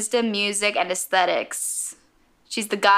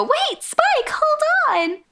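put-on very high, girlish call; little blonde waitress; slower than usual. Blonde waitress stopping Spike as he leaves, high girlish voice. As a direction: squeak it out as the waitress calling him back. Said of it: "the little blonde waitress go".

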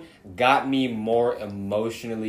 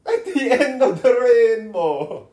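high, loud mock-Irish cry; hammed-up Irish accent, drawn out slowly; 9 dB louder than usual. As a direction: belt it out in a silly Irish lilt. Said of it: "So you dissing my Irish accent?".

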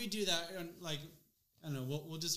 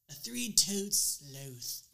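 slow, drawn-out British nature-documentary read; slow British accent, savouring every word; British narrator accent; 3 semitones higher, 5 dB louder, much slower than usual. Retold or imitated. Imitating the British narrator's slow accent. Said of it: "he says sloth's really funny".

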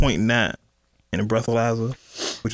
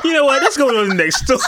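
higher, laughing as you bail out; laughing give-up; 11 semitones higher, 4 dB louder than usual. Real, not acted. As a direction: give up on the joke, still laughing. Host gives up, laughing.

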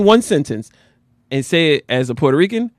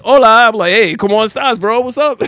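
louder, high-pitched bilingual hello; energy-matching greeting; 5 semitones higher, 4 dB louder than usual. Acted. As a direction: greet a buddy at the door with gusto. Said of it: "I match your energy".

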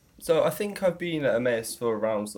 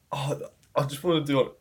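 ordinary talk, nothing marked

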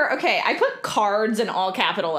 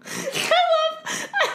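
laughing; slower than usual. Real, not acted. Laughing fit continues.

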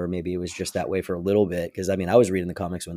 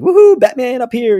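much higher, louder, giddy taunt; loud, high, crazed villain hoot; Frank Gorshin Riddler; 14 semitones higher, 10 dB louder than usual. Retold or imitated. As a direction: cackle it like a manic villain. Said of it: "the Frank Gorshin kind of thing".

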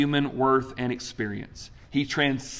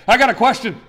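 eager student; 7 semitones higher, 7 dB louder, faster than usual. Retold or imitated. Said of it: "She raises her hand".

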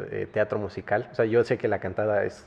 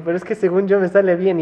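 mock protest; 8 semitones higher, 6 dB louder than usual. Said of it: "Y yo".

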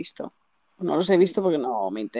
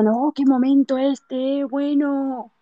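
mock dramatic; 6 semitones higher, much slower than usual. Quoting her own reaction.

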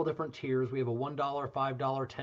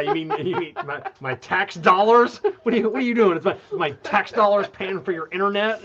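mock outrage; 6 semitones higher, 9 dB louder than usual. Joking outrage over tax dollars.

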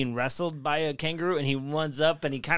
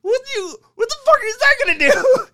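high, loud mock outburst; incredulous yelling; 9 dB louder than usual. Storyteller reacting to the man throwing the bobcat, hammed-up incredulous yell. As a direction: yell in exaggerated bewilderment. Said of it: "It's like".

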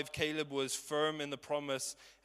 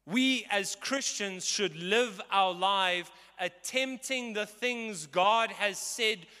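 slow, loud, rising preaching; preaching at full force; 8 semitones higher, 8 dB louder, slower than usual. Acted. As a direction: drive the point home from the pulpit. Preaching climax.

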